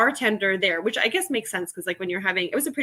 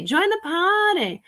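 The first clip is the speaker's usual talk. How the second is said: party call; 9 semitones higher, slower than usual. Acted.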